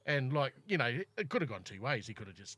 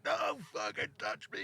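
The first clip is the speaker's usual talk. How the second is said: put-on much higher, snapping drunk voice. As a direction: fend off the hand with a high snap. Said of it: "and he said".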